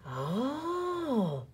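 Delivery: soft admiring sigh; a breathy dreamy oh. Acted. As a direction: sigh in wonder. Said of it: "the crowd sighed".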